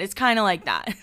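ordinary talk, nothing marked

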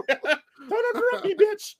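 very high, slow squeaky mouse snapping; Mickey Mouse voice. Pretend. Mickey cutting off the hosts, in a high squeaky mouse voice. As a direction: snap like a gangster cartoon mouse.

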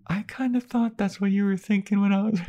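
high falsetto, coy; squeaky and coy like a flattered girl; Willow voice. Retold or imitated. Voicing Willow.

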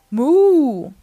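loud, rounded moo; long swelling moo; cow moo. A narrator's reading. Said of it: "Cow said".

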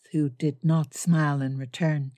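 usual speaking voice

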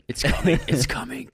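ordinary delivery

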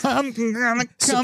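high mock orgasm voice.

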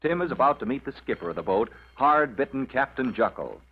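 usual speaking voice

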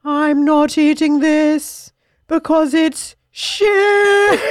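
slow, high, laughing mock refusal; mock defiant kid. TikTok teen refusing food to his parents, laughing mock. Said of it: "He's like".

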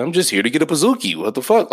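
high, loud and fast fake-innocent excuse; mock innocent brother. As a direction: brush it off as if nothing is wrong.

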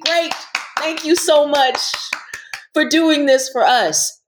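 high, effusive gratitude; acted-out, high-pitched appreciation; mock gushing thanks. Voicing thanks women never hear.

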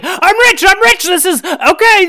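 high, loud delighted shopkeeper voice; gleeful shopkeeper. Acted. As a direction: shopkeeper suddenly thrilled with the money, gushing. Shopkeeper gleeful over money.

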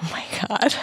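breathless whisper. Real, not acted. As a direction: whisper it in shock. Listener reacting to scene.

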